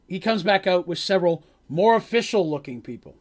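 normal talking voice

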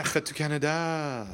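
playful French pronunciation; French accent. Acted. Echoing the French name.

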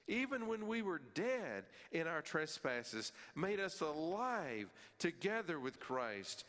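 preacher reading scripture aloud; fervent scripture reading. Acted. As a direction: proclaim the verse with building fervor. Reads scripture with rising fervor.

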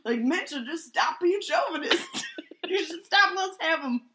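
loud, laughing rant; mock-outraged while laughing. Real, not acted. Laughing summary of the review.

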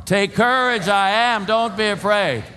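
high, loud rallying shout. Shown as a demonstration. Preacher rallying congregation in a storm, shouting. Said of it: "a little louder 'cause we're in a storm".

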